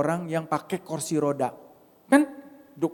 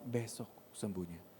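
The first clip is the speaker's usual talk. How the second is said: much softer, low "Besok sembuhnya"; barely heard divine whisper; quiet inner voice; 6 semitones lower, 16 dB quieter than usual. Retold or imitated. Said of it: "Saya cuma dengar suara". (suara batin yang sangat pelan)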